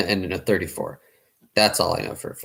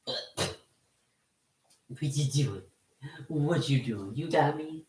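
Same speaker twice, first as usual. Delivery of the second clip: talking to a dog; 6 semitones higher, 8 dB quieter than usual. Real, not acted. Playing with the dog.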